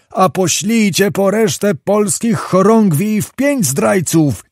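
slow, drawn-out high-pitched call; narrated shouting. A narrator's reading. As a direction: call out a long order to those below. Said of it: "Zagłoba sam począł krzyczeć". (przeciągłe wołanie podniesionym głosem)

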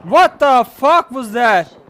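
loud, high, slow disbelief; shocked yell. Real, not acted. Gamer startled by what happened, shocked yell. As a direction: yell it out slowly, word by word.

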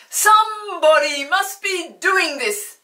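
Mister Archimedes shouts at his friends; shouting. Acted. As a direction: shout it in outrage. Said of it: "he shouted".

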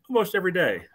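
ordinary delivery

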